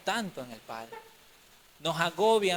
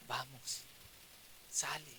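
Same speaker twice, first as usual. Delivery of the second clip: gentle urging; 3 semitones lower, 15 dB quieter, slower than usual. Acted. Dios invita suavemente.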